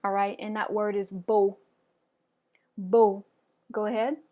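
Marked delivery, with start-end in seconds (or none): 1.1-1.6 French "beau" said as "boh"; silent final X. Shown as a demonstration. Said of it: "we just don't pronounce it". 2.8-3.2 crisp French "Beau", said as "boh"; silent final X. Shown as a demonstration. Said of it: "we just don't pronounce it".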